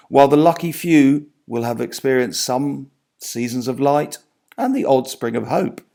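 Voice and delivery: usual speaking voice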